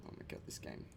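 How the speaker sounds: whispered under the breath; barely voiced despair at the game; exasperated whisper. Real, not acted. Whispering in frustration at the game he is playing.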